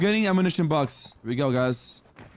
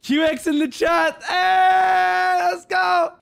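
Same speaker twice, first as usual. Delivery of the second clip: high, loud cheer; excited shout; 10 semitones higher, 4 dB louder, slower than usual. Real, not acted. Streamer cheering the chat, excited shout.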